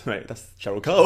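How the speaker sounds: normal talking voice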